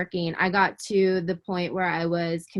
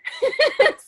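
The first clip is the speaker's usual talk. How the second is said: laughing; 5 dB louder than usual. Real, not acted. Laughing at herself.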